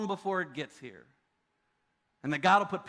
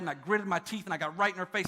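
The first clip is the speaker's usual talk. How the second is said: ordinary talk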